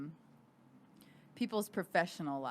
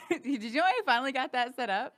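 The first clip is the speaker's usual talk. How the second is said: laughing; 7 semitones higher, 6 dB louder, faster than usual. Real, not acted. Excited Patreon brag with laugh.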